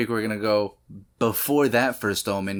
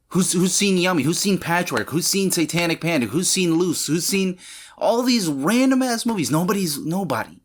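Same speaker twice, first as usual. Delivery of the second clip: worked-up rant; 8 semitones higher, 4 dB louder than usual. Real, not acted. Rant listing obscure movies.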